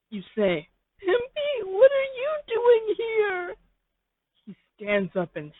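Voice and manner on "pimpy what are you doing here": very high, slow wailing complaint; high slow crying whine. Acted. The listener moping alone in a slow high wail. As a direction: whimper it out slowly.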